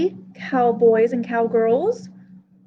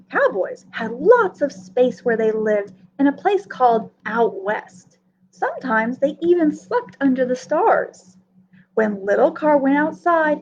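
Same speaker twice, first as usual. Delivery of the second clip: ordinary delivery, nothing marked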